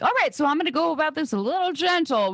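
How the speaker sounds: gently cautious. Retold or imitated. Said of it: "And I'm like".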